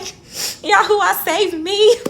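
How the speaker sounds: high, pleading cry to God; desperate prayer. Retold or imitated. Stuck without her keys, praying to God in a high desperate plea. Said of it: "talking to God the whole time".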